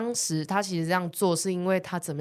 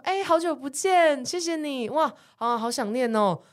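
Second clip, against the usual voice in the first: overly warm greeting; 7 semitones higher, slower than usual. Retold or imitated. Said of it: "我讲话很大声".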